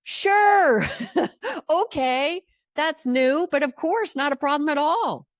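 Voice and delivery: high, bright-toned drive-through clerk voice, happy to help; chirpy voice over the drive-through intercom; cheery drive-through voice. Retold or imitated. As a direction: play the eager drive-through speaker. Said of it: "what the disembodied voice says".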